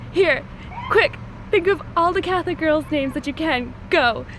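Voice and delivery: retelling the editor's playful rush; brisk urging. Retold or imitated. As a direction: hurry her along like a quiz host. Editor hurrying her to brainstorm.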